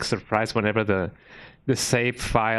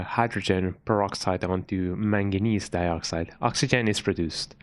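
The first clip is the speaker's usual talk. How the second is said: ordinary talk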